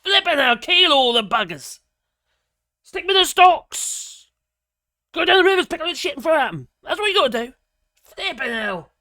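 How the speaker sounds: loud, high, furious ranting; angry rant. Real, not acted. Vlogger raging at those in charge, loud angry rant. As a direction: shout it like you want a mob out.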